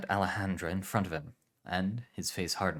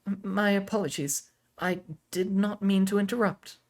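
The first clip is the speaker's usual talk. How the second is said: much higher, stiff and formal; stiff young woman; 13 semitones higher, 5 dB louder than usual. Acted. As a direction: apologize stiffly, high. Aurelia apologizes.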